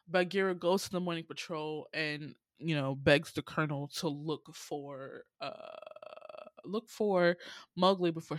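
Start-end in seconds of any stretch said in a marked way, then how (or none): none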